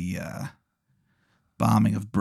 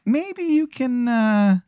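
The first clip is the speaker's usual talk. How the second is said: high, slow Baltimore wizard voice; bad Maryland accent; 14 semitones higher, slower than usual. Wizard feels Adam out for help, bad Maryland accent. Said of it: "the worst Maryland accent".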